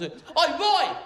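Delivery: high, boorish Brit-abroad hollering; barking at friends. Acted. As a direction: call out to a mate across the street, high and coarse. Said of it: "barking at our friends".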